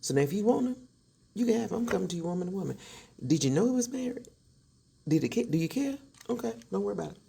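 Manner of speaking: put-on wife warns husband's girlfriend, heated; fed-up warning. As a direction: lay it down firmly, woman to woman. Confronting the mistress.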